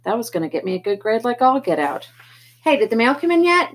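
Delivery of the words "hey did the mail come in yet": loud call from the door; yelling. Acted. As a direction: call out across the house. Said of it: "yelled for me".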